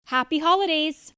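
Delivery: loud, fast holiday cheer; bright festive sign-off; cheerful greeting. Real, not acted. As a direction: call it out happily.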